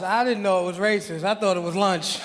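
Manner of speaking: ordinary delivery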